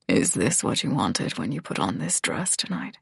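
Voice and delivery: low, hushed male character; deep, softened growl of a voice; gruff grunt. A narrator's reading. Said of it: "he grunts".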